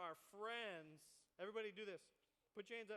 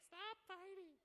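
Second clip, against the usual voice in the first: much higher teacher's scold; little teacher voice; 9 semitones higher, slower than usual. Retold or imitated. Preacher imitating a small teacher stopping the fight, high-pitched. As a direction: squeak out the order like a tiny teacher. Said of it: "She's like".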